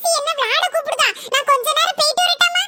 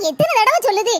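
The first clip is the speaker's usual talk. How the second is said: ordinary talk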